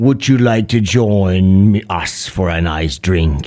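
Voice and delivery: low creepy invitation; deep put-on oddball voice; freaky weird guy. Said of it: "a freaky weird, he's like".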